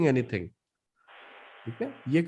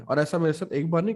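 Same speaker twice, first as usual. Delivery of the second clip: usual speaking voice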